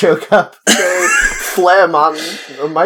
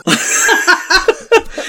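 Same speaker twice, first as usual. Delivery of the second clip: choking laugh. Real, not acted. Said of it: "I'm choking on a peanut".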